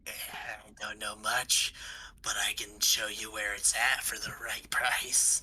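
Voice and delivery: put-on soft, whispery old miner voice; decrepit old dwarf. Old dwarf bargaining for the right price, whispery. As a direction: whisper it like a sly, withered dwarf. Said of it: "And he's like".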